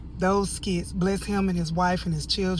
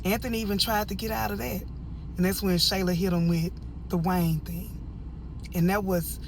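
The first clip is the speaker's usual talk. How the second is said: ordinary talk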